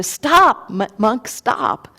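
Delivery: sudden high yell, then fading; shout. Acted. Bandit yelling after the monk, loud high shout then fading. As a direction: call him to halt, loud at first. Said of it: "he yells to the Buddha".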